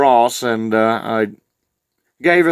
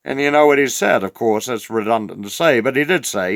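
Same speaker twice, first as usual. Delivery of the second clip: ordinary talk, nothing marked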